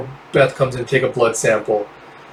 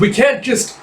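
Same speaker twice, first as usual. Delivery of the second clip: much higher cut-off protest; 10 semitones higher than usual. Acted. A teammate refusing to flee, much higher protest. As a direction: protest leaving in panic. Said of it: "It's like".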